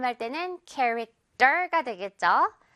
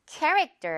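캐릭터 stressed on 캐, ending in a weak 떨; 캐릭터 as English character, front-stressed; stress on first syllable; 6 semitones higher than usual. Shown as a demonstration. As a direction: hit the front of 캐릭터 and let the end drop. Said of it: "앞부분에 강세 있다 보니까요".

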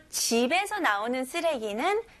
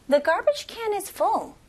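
much higher, projected model sentence; big practice voice; 8 semitones higher, much slower than usual. Shown as a demonstration. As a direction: lift it high for the class to repeat along. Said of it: "큰 목소리로 같이 해볼게요". (큰 목소리로 따라 하도록 높여서)

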